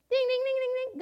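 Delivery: high sing-song ding ding; mock bell ding. Preacher mimics ringing God's prayer bell, high sing-song dings. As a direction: chime like a little service bell. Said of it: "We just ring our little prayer bell".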